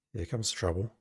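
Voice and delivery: soft, low grumble to himself; mumbled under breath. A narrator's reading. Bartender grumbling as the hero walks in, softly muttered. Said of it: "mumbles under his breath".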